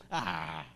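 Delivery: low mock gasp of shock. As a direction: gasp like the congregation would. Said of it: "você vai ficar assim".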